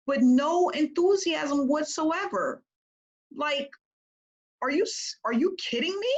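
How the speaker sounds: high, slow astonished outburst; exasperated disbelief. Real, not acted. Coach exasperated by dull video.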